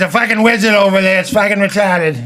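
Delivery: put-on a Boston guy blurting it out in a Boston accent. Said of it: "it wouldn't work with Boston".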